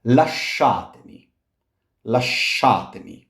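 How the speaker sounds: "Lasciatemi" with sh, la-SHA-te-mi. Shown as a demonstration. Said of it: "gives us sh".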